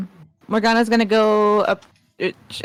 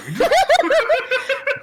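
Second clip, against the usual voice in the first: high giggling laugh. Real, not acted. Laughs at imagined Box.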